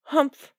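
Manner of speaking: a short disdainful huff; scornful humph. A narrator's reading. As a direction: huff at her dismissively. Señora bufa. (bufido desdeñoso)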